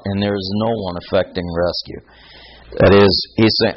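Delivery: ordinary delivery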